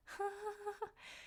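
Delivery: high, soft re-enacted giggle. Patient after joking with the transporter, high giggle. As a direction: giggle softly, far up in pitch.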